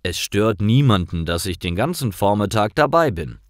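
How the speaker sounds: ordinary delivery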